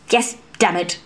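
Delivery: low, mildly angry growl; lightly voiced annoyed swordswoman. A narrator's reading. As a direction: grumble it through irritation. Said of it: "she growled".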